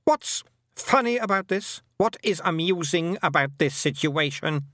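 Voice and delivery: high, indignant questioning; demanding. A narrator's reading. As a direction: demand an explanation as an offended queen. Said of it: "she demanded".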